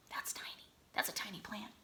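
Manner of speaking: hushed whisper of wonder; awed whisper to children. Acted. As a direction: whisper it like it's precious. Marvelling at plant size.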